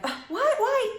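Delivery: high, startled "what, why?"; taken aback, pitch jumping way up; surprised. Retold or imitated. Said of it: "when you're like".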